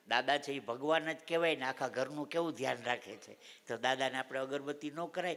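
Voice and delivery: put-on a child's words echoed softly and fondly; soft fond repeat. Grandpa softly repeating his granddaughter's clever answer. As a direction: let it fall quiet, savouring the line.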